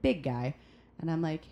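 ordinary talk, nothing marked

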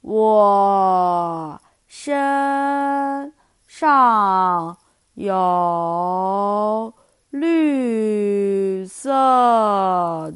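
very slow, drawn-out sloth voice; super slow sleepy sloth. Acted. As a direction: stretch every word like a sleepy sloth. Sloth speaks again.

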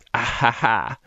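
low fake ha-ha-ha; deadpan hammed-up laugh; mock laugh.

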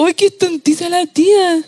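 lightly voiced teasing little boy, retold; a touch of a smug five-year-old; mocking child. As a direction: quote the cheeky kid again. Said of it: "Un pendejo de cinco años".

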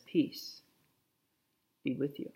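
softly whispered peace; preacher voicing Jesus, whispering peace to the congregation; whispered blessing.

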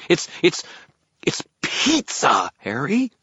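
high, stammering fairy indignation; stammer, then stretch the key word out loud; outraged fairy. A narrator's reading. Fairy outraged that pizza is called fair, high sputtering.

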